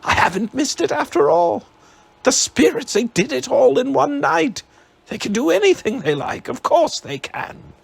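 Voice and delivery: put-on old man thrilled he didn't miss it; thrilled Scrooge. A narrator's reading. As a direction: tumble out the words in delight.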